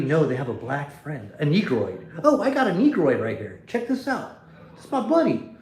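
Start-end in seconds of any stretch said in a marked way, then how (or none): 2.2-5.6 mock show-off. Streamer mocking so-called whites who show off a friend, hammed-up higher voice. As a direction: ham up a proud show-off introducing his buddy.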